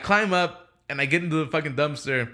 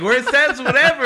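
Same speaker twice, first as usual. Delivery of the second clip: laughing retort; 10 semitones higher, 7 dB louder than usual. Retold or imitated. Retells reply to angry coworker.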